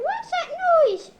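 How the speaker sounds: ordinary talk, nothing marked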